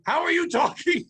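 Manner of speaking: high-pitched, incredulous retold question; alarmed disbelief. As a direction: blurt it in freaked-out astonishment. Kids' alarmed reply to pinned boy.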